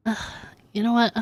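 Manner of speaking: normal talking voice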